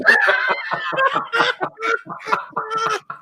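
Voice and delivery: high shrieking laugh; real laugh breaking out high. Real, not acted. Laughing at his own bad-acting impression, high shrieking laughter.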